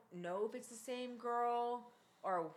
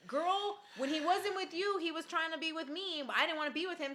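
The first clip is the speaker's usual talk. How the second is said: sassy taunt; 7 semitones higher, 3 dB louder than usual. Real, not acted. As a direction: talk straight to her, smug. Addressing the girlfriend, sassy.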